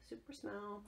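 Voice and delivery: very soft, almost under the breath; hushed quoted reply. Retold or imitated. Answering people amazed at her nose, hushed. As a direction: drop right down for a hushed answer.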